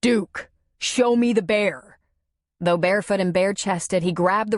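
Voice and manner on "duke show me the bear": mild yell. Acted. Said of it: "he yelled to the dog".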